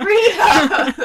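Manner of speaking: loud laughing shout of a name. Retold or imitated. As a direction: yell the name through laughter. Echoes the call loudly.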